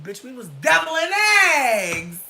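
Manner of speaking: loud exasperated yell; shouted punchline; shouting. Real, not acted. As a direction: belt it out in disbelief. Exasperated retelling of egg plan.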